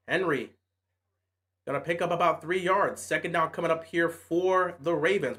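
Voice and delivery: calm broadcast narration; play-by-play commentary. Acted. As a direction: talk through the drive like a TV booth. Calls his own game like commentator.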